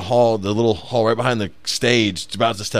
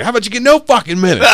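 angry retort; 13 semitones higher, 8 dB louder, faster than usual. Retold or imitated. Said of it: "the guy's like".

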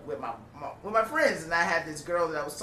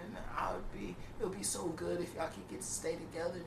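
soft, half-whispered plea; mock earnest hush; 2 semitones higher, 9 dB quieter than usual. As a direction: hush it like a secret wish. Sensitive friend confides emotionally.

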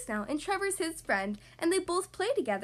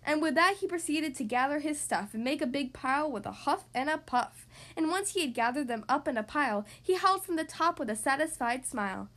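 ordinary delivery, nothing marked

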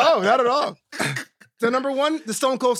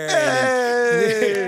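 loud hype yell; shout; 5 dB louder than usual. Acted. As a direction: cheer like a live studio crowd. Faking game show fanfare.

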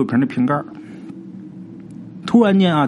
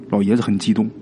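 ordinary delivery

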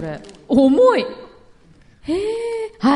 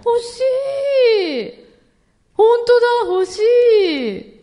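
excited; 9 semitones higher than usual. Real, not acted. Reacting to the unique prize.

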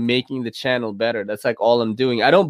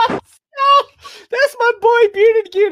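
much higher, loud excited yell; excited shout; 6 dB louder, slower than usual. Real, not acted. Shouting out his friend to the viewers, excited. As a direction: hype up a buddy joining in.

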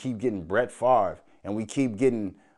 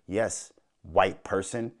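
slow sarcastic retort; 4 semitones lower, slower than usual. Real, not acted.

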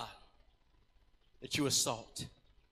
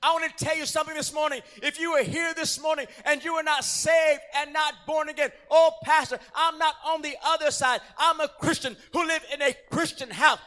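climactic preaching; 5 semitones higher, 13 dB louder than usual. Acted. Altar call climax.

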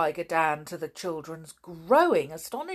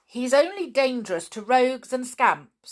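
indignant; 8 semitones higher, 9 dB louder than usual. A narrator's reading. George snapping back.

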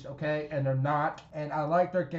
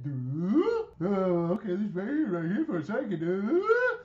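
mocking a clueless wrestler; 3 semitones higher than usual. Host mocks Ivy Nile just standing there, dopey voice. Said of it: "stand there like that".

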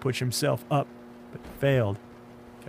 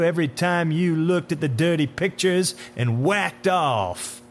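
unhinged tirade; 2 semitones higher, 5 dB louder than usual. Acted. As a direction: berate him with drawn-out contempt. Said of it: "Something came unhinged in the man".

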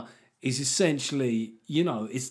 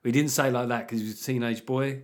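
ordinary talk, nothing marked

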